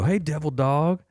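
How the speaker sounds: loud, high, slow call across the lot; mock sergeant call. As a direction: hail a marine from far off. NCO calling out across area.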